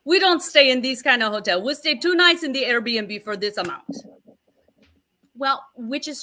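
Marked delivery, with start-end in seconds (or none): 0.0-4.0 higher, louder mock Jimmy accent. Husband objecting to the fancy hotel, in a mocking Jimmy accent. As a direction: ham up the husband's Jimmy accent while he scolds. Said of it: "Can you do the Jimmy accent too?".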